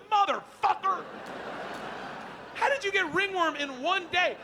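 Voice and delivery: alarmed outburst. Retold or imitated. Dad hearing about ringworm, alarmed. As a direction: blurt it in horror.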